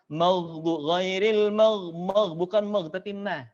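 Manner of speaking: normal talking voice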